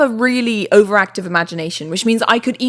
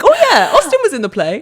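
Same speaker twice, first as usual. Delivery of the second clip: higher-pitched, laughing "oh yeah"; mock realization; 7 semitones higher, 3 dB louder than usual. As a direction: act out suddenly remembering. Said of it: "I'm like".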